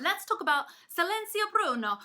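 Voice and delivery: exaggerated Italian accent, excited. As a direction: Silencio Bruno in a playful mock Italian accent. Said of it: "the only time I'm gonna do an accent".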